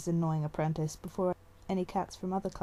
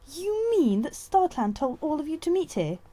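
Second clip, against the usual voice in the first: high, loud indignant outburst; young she-cat bursting out; 10 semitones higher, 5 dB louder than usual. A narrator's reading. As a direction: blurt it out in shock. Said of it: "the young she-cat burst out".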